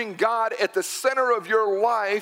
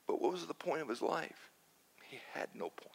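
very soft, dropping to near nothing; hushed sombre verdict; 4 semitones lower, 16 dB quieter than usual. Acted. Preacher concludes the mock funeral, hushed and sombre. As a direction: let it sink away quietly and low.

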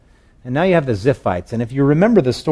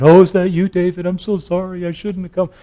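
Saul crying out; 7 semitones higher than usual. Acted. King apologizing to David across the night, high cry. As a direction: wail an apology, hammed up. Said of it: "then Saul cries out".

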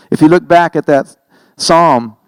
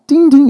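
phone ding; 14 semitones higher, 3 dB louder than usual. Pretend. Imitating phone notification tone.

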